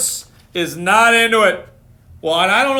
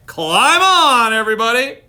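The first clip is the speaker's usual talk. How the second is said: a touch louder call; mild shout; 5 semitones higher, 4 dB louder than usual. Acted. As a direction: call the others aboard, lightly. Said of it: "Duck shouted".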